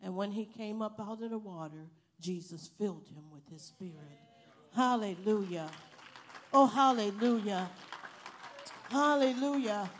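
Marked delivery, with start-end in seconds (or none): 4.7-9.9 loud shouted hallelujahs, slowing at the end; ecstatic praise. Real, not acted. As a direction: cry out praise with full force. Preacher's closing praise.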